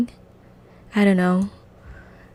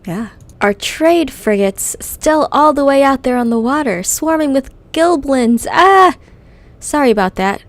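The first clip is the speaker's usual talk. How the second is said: loud, higher manic goblin; Crazy Larry; 4 semitones higher, 6 dB louder than usual. Acted. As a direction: hype every line up. Reads Crazy Larry's lines.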